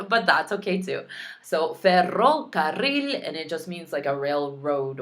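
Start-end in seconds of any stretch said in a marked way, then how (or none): 1.4-3.3 ferrocarril said slowly with a full rolled rr; slow trilled R. Shown as a demonstration. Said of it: "The double R".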